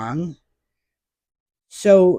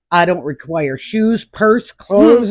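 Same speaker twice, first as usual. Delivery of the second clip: mock-earnest list, rising higher and louder; joking; 4 semitones higher, 7 dB louder, slower than usual. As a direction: playfully build up each item. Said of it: "I was joking with him".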